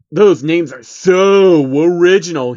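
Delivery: slow, loud, dripping sarcasm; loud slow mock compliment; sarcastic. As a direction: drag it out with a mocking sneer. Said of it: "he said sarcastically".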